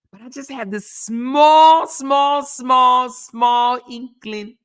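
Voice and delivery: slow, high, drawn out small, small, small; milk each small for sarcasm; sarcastic emphasis on small; stretched repeated small. Real, not acted.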